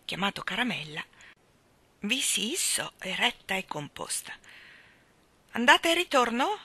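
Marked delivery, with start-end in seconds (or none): 5.5-6.6 ticket man asking. A narrator's reading. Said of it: "le domandò il bigliettaio".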